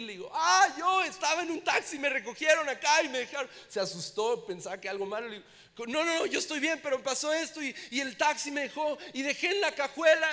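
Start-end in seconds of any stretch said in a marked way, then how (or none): 0.3-3.5 high, loud, rushed explaining; a much higher, louder flustered voice; frantic phone call. Retold or imitated. Frantic call to taxi company. 5.9-10.3 flustered reassurance. Retold or imitated. Reassuring operator, still frantic.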